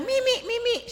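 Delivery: put-on very high, loud toddler cry; child's shout. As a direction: yell for milk like a toddler. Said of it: "pag sumigaw siya ng". (sigaw ng batang humihingi ng gatas)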